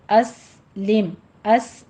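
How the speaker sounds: اسلم with a long hissing س; whistled sin. Shown as a demonstration. Teacher shows the hissing سین in اسلم. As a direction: let the س of اس whistle. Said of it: "سیٹی کی آواز آئے گی".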